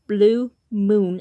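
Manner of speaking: weighty, drawn-out stress on BLUE MOON; emphasized. Shown as a demonstration. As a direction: lean hard on BLUE and MOON. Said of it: "I emphasize blue moon".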